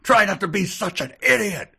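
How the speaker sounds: high, mock-angry snapping, laughing through it; a prince playing furious but cracking up; hammed-up angry prince. Prince scolds Billy at the table, high hammed-up anger. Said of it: "Prince Rayshawn said angrily".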